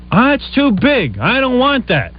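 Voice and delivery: high, drawn-out mock complaint; mocking high-pitched whine; whiny refusal. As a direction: imitate a wife rejecting it, slow and high. Wife refusing his suggestion, retold.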